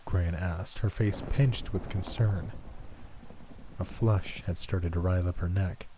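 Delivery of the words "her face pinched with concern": plain reading voice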